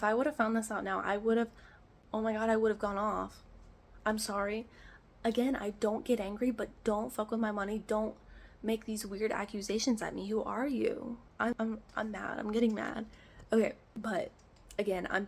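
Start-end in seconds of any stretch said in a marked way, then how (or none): none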